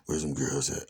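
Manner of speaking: low, understated menacing demand; changed, serious demeanor. Retold or imitated. Imitating Alan asking after the girls, changed, serious demeanor. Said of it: "his whole demeanor changed".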